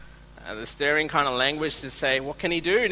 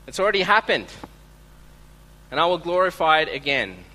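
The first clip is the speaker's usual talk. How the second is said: booming heavenly voice; 3 semitones higher, 6 dB louder than usual. Retold or imitated. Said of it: "And the voice says".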